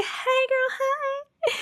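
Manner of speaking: very high, soft squeaky repeat; tiny high echo. Pretend. Streamer echoing a viewer's greeting, tiny and high. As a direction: echo the call softly, way up high.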